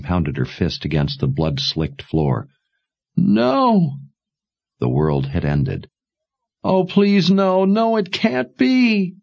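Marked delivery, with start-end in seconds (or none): 3.2-4.0 high anguished no; high despairing cry. Acted. Daughter wails at loss. 6.6-9.2 high, wailing plea. Acted. Daughter wails at loss.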